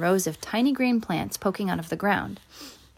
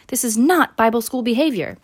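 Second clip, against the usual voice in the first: scolding holler; 5 semitones higher, 5 dB louder than usual. A narrator's reading. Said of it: "she hollered".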